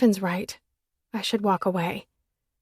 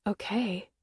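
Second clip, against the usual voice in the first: disbelieving okay, falling; stunned echo of okay; incredulous; 7 dB quieter than usual. A narrator's reading. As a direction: throw his okay back at him in disbelief. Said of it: "That's not the right answer".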